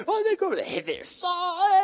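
very high, hammed-up sneaky voice; giddy discovery. Acting out the sneakers spotting Saul, high and giddy. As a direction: squeak it out as a mock discovery.

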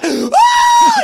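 high, loud yelp of excitement; excited crowd shout. Retold or imitated. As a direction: shriek it like a thrilled kid. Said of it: "the boys are".

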